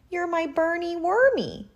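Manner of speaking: high, gushing aunt voice; doting aunt. A narrator's reading. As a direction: coo a pet name at a nephew.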